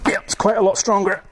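talking through a hard physical effort; straining with effort. Real, not acted. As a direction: push the words out while straining hard. Straining to break board by force.

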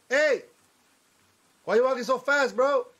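high, loud hey-bro greeting; shouting a greeting at a stranger; loud call. Pretend. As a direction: holler at the passerby. Calling after passing NPC.

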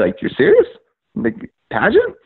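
retold stunned double-check; incredulous. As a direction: voice leaps up high in disbelief. Reacting to a baffling objection.

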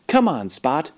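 ordinary delivery

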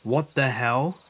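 mild startled shout, rising at the end. A narrator's reading. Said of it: "I woke up shouting".

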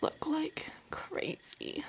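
slow whispered aside. Real, not acted. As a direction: whisper it to yourself.

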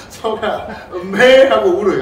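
laughing moo. Retold or imitated. Teacher to listeners, hamming up the cow's moo with a laugh. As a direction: moo 음메 big and loud through laughter. Cow lowing, acted with laughter.

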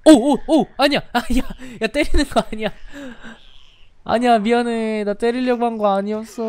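high, flustered protest; panic, you didn't mean it; panicked apology. Real, not acted. Accidentally hits turtle, panics.

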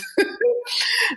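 quick amused burst; high laugh. Real, not acted. Laughs about eighteen years.

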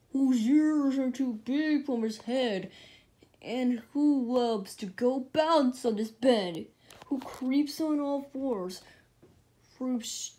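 grand mysterious riddler; Sphinx voice. A narrator's reading.

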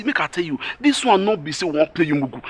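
normal talking voice

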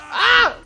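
sudden high-pitched cry of pain; sharp high yell. Real, not acted. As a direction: yelp as the hit lands. Hit during fight.

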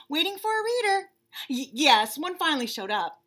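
high, excited chatter, tripping over words; gossiping bunny on phone. Acted. Bunny chatting eagerly with Vern on the phone, high. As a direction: gush to a friend.

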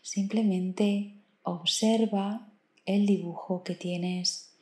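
soft, slow meditation voice with long pauses; guided meditation. Acted. Meditation guide leading the listener through counted breathing, calm and slow. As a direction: count the breaths softly, stretching each instruction.

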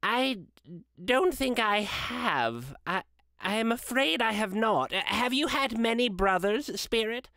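humbled Scrooge answering the spirit; meek Scrooge. A narrator's reading. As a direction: stammer a timid reply. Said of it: "Scrooge answers meekly".

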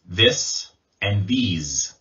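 "this and these", short I against long E with a Z, slow; contrasting vowels. Shown as a demonstration. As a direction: slowly set "this" apart from "these". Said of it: "that distinguishes".

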